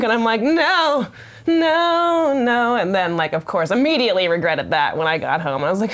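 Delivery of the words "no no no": high, hammed-up string of noes; mock-panic, pitched way up; panicked refusal. She recalls overthinking drunk with her date, mock panic.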